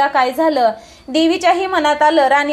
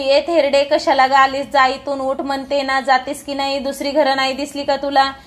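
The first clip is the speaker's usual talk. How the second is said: plain reading voice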